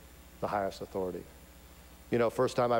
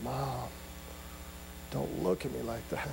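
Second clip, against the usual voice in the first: subdued pleading; 7 dB quieter than usual. Acted. Child pleading with disappointed mother.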